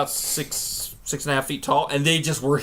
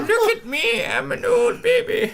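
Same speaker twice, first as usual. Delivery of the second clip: put-on very high squeaky baby-old-man voice; slower than usual. Voicing baby self.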